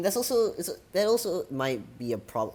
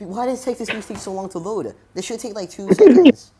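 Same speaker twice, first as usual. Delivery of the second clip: rising exasperated rant, high and loud; 9 semitones higher, 11 dB louder than usual. Real, not acted. Exasperated at slow text-to-speech.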